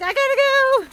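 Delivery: very high mock dog voice; voicing the dog. Walker speaks for dog leaving, high put-on voice. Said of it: "Yeah, he's like".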